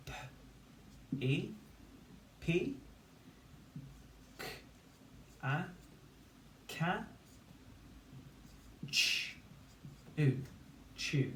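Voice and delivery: isolated sounds with long pauses, then the blended syllable; sounding out syllables. Shown as a demonstration. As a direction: build "Pikachu" one syllable at a time. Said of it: "say the sounds, one syllable".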